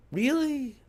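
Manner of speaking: a near-shout of disbelief; outraged disbelief. Real, not acted. Vlogger venting about the insult, loud incredulous really?.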